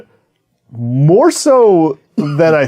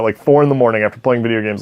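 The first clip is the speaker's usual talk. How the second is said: ordinary talk, nothing marked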